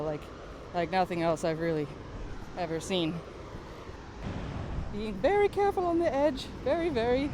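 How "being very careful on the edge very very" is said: nervous careful. Real, not acted. Walking near the edge.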